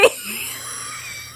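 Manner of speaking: very high breaking laugh; squealing laugh. Real, not acted. Teacher cracking up at the story, high squealing laugh.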